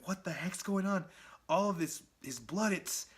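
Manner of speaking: bewildered thoughts racing; Spider-Man's inner voice. Retold or imitated. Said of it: "that's Spider-Man in his head".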